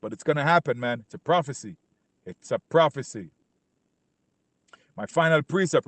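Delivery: normal talking voice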